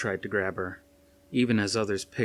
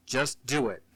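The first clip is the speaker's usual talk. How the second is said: higher, distinct commanding official; cold boss giving orders; 4 semitones higher than usual. A narrator's reading. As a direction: issue orders with clipped authority. Kane closes his orders.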